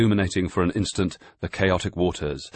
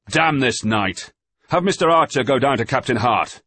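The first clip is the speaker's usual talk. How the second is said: angry shout; 9 semitones higher, 7 dB louder than usual. A narrator's reading. Master cursing the storm and ordering a man below, angry shout. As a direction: curse the night and snap the order.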